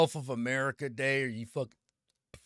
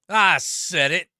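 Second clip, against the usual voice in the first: louder and slower, daring anyone to object; defiant, louder; 4 semitones higher, 10 dB louder, slower than usual. Real, not acted. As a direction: plant your feet and repeat it slowly. Doubling down on hot take.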